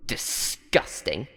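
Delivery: soft, slow whispered sneer; disgusted whisper. Acted. Host whispering disgust at the answer, slow. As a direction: spit it out in a hush.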